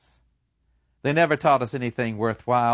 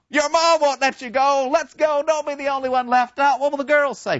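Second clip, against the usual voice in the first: high teasing persuasion; coaxing schoolboy; 4 dB louder than usual. Retold or imitated. Boys coaxing Kemerick to join up, high and teasing. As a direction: egg him on.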